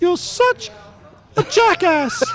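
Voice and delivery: fed-up, high squeaky bunny voice; high-pitched indignant puppet rabbit; Sid. Acted. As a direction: squeak your exasperation like a cartoon rabbit. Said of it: "in Sid's voice".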